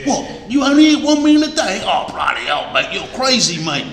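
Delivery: astonished Australian cockney, only punched loud and fast; hammed-up Australian cockney disbelief; mock Australian cockney. Preacher mocking a friend shocked by one meal a day in Australian cockney. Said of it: "Some Australian cockney".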